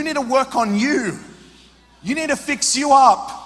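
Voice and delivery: loud, high shout; impassioned shouted rebuke; preaching shout. Real, not acted. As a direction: hammer the line home.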